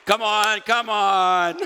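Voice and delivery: loud, high, drawn-out exhortation; rally the crowd at full voice; rousing cheer. Real, not acted. Preacher rousing the congregation after a joke, loud and excited.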